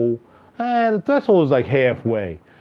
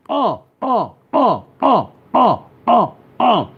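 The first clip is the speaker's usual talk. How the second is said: high, loud uh uh shouts; loud vocal ad-libs; 9 semitones higher, 4 dB louder, slower than usual. Shown as a demonstration. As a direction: belt out short uh sounds. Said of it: "see how loud it is when I do that".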